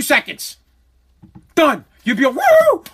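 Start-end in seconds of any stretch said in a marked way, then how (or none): 2.3-2.8 very high, loud whoops of joy; ecstatic whoop. Pretend. You're offered a million for life, whooping high and loud. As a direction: whoop like you just got rich. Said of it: "all over it".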